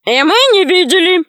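high, eager denial; high-pitched mock-innocent cry; chorusing bandits. A narrator's reading. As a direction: chime in loudly in a high voice.

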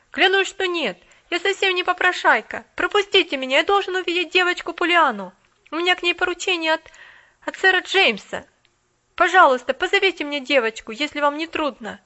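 put-on a street boy pushing past the maid; pleading boy. A narrator's reading. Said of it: "раздражённые голоса".

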